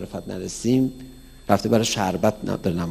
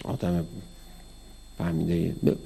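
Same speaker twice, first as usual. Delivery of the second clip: usual speaking voice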